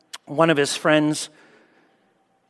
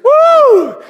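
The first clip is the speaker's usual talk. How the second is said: very high, loud "woo"; joyful whoop; 8 dB louder than usual. Retold or imitated. Boy flying over the bump, whooping. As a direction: imitate a kid's airborne squeal of glee. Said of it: "all I hear is".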